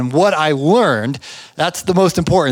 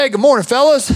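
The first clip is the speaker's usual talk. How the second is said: cheery, higher boss greeting; friendly boss; 8 semitones higher, 3 dB louder, faster than usual. Retold or imitated. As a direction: boss strolling out to greet the crew, higher and chummy. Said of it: "He's like".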